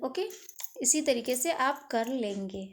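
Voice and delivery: ordinary talk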